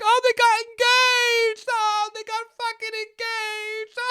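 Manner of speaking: very high, slow squealing aunt voice; imitating aunt. Mimicking his aunt squealing about the engagement, very high. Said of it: "She'd be like".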